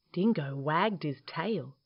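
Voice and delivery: ordinary delivery, nothing marked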